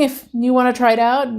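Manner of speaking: ordinary talk, nothing marked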